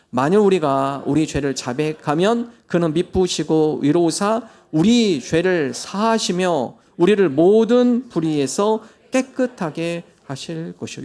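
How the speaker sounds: slow, measured reading of the verse; solemn scripture reading. Acted. Pastor reading the verse to the congregation, slow and solemn. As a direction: read it slowly like holy text. Scripture read aloud slowly.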